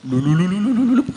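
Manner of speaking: bubbly gurgle like a water jug; comic gallon glug noise; gurgling water sound. Shown as a demonstration. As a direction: blub the lips like a water gallon glugging. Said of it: "Kayak suara galon itu loh".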